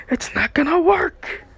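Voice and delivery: high, rising teasing line; singsong taunt. A narrator's reading. Narrator mocks their hopeless excuses, singsong taunt. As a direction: lilt it upward as a taunt.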